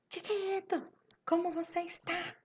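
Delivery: put-on high-pitched exclaiming to a child; exclamação. Shown as a demonstration. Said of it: "de exclamação". (exclamando em voz bem aguda)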